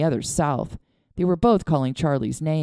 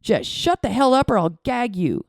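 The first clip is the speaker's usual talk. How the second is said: mild snarl; 6 semitones higher, 4 dB louder than usual. A narrator's reading.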